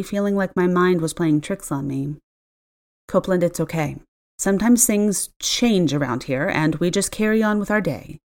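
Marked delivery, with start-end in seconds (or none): none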